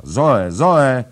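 loud, high call across the house. A narrator's reading. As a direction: yell for her girl. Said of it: "Woła".

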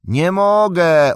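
high, slow, loud call; drawn-out high yell; shouted reply. A narrator's reading. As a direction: shout back helplessly from below. Shouted answer from cliff ledge.

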